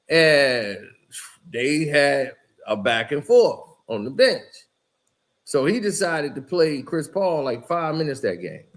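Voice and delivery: ordinary talk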